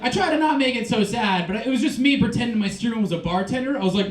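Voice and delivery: ordinary talk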